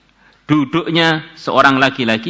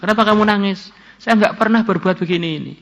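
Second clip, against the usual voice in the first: higher, puzzled questioning man; Kifl asking; 8 semitones higher than usual. Acted. Kifl asks the weeping woman why, raised voice. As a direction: ask her why she cries, puzzled.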